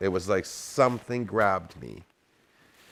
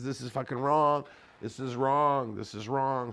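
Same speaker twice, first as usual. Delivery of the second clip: worried John; 6 semitones higher than usual. Retold or imitated. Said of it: "he says".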